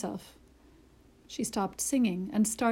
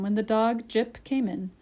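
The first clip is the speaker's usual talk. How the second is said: ordinary delivery, nothing marked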